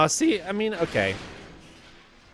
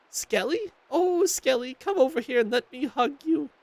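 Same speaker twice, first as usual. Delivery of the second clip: much higher doting grandma voice; overjoyed grandma; 13 semitones higher than usual. Acted. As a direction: gush over him, way up high.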